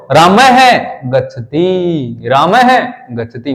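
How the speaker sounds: रामाय drawn out to raama-ha, halt, then गच्छति; slow right down on रामाय, then release गच्छति; stop, then go. Shown as a demonstration. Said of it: "बीच में रुक कर फिर भागी". (रामाय पर रुककर, फिर गच्छति)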